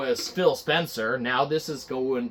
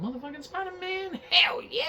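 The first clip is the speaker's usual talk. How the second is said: much higher squeaky fanboy cheer; hyped Phil Spencer; 14 semitones higher, 6 dB quieter than usual. Acted. Phil Spencer cheering at his superhero cup, much higher pitched. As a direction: gush like a kid over his Spider-Man cup. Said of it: "We're gonna do a little reenactment".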